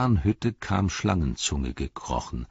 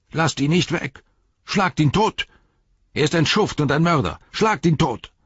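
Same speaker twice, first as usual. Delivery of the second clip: high, loud furious yells; angry mob shouting; 10 semitones higher, 5 dB louder than usual. Acted. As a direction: shout for his death. Angry hobbit crowd shouting.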